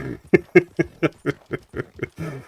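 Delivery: high, bubbling run of giggles; laughing. Real, not acted. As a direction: laugh at your own sarcasm. Laughs after admitting sarcasm.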